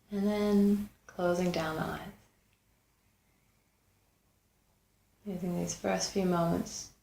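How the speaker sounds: unhurried meditation guide, long pauses between phrases; guided meditation. Acted. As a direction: guide them gently inward, leaving space after each phrase.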